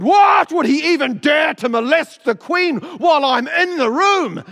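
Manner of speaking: furious roar, high and loud; roaring tyrant king. Acted. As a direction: bellow like an outraged king. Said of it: "And the king roared".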